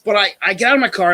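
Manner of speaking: ordinary talk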